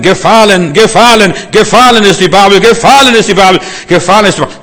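high, loud, repeated proclamation; relentless ringing battle cry; angel's battle cry. Acted. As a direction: shout it over and over like a herald.

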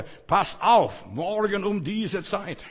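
loud, very slow doom-laden proclamation; ominous prophet's warning. Retold or imitated. Preacher mocking a prophet of doom with a loud, very slow warning. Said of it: "Da sagt er".

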